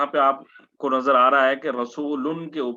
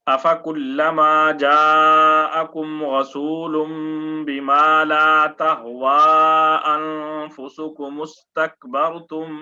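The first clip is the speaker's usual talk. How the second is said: Quran recitation; 5 dB louder than usual. Acted. Teacher recites the verse for his students in melodic tilawat.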